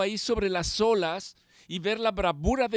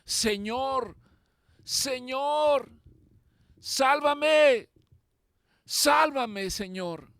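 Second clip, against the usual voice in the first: rising, ever louder plea that cracks on sálvame, then falls away; desperate cry for help; 7 semitones higher, 5 dB louder, much slower than usual. Acted. Peter sinking in the sea, crying to the Lord in desperate fear. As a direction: cry out like a man going under. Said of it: "exclamó y dijo".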